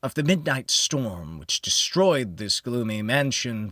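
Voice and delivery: usual speaking voice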